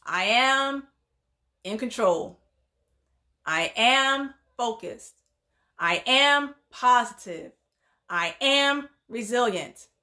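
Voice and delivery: slow, deliberate, pausing between phrases; drawn-out AM, then the word set down firmly; leading affirmations. Shown as a demonstration. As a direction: lead a group repeat-after-me affirmation. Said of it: "Say it with me".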